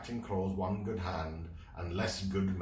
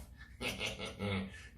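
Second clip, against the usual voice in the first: snorting pirate laugh; nasal laugh; 2 semitones higher, 6 dB quieter than usual. Acted. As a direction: laugh through the nose. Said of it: "gave a nasal laugh".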